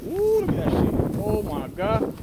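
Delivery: high, loud exclamation of surprise; surprised. Real, not acted. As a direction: gasp out loud at the sight. Surprised by the snowstorm.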